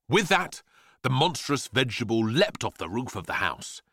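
ordinary delivery, nothing marked

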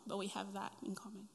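ordinary talk

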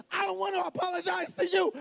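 high-pitched imitation of a defiant child; daughter yelling back. Daughter refuses dad an apology, mock defiant yell. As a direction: act out a little girl's yelled refusal. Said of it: "you yell at me".

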